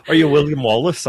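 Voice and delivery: a lightly put-on Scottish accent, half laughing; bad Scottish accent. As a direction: try a Scottish accent and crack up. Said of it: "a terrible Scottish accent".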